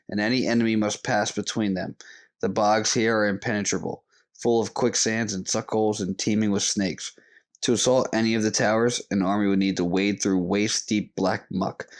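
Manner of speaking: low, even book reading; reading aloud. Acted. Reads book passage aloud.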